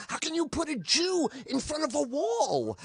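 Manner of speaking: high mock-outraged wail; hammed-up indignant cry, pitched high; angry and afraid. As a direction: imitate a panicky, indignant comic. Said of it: "like he's angry and afraid".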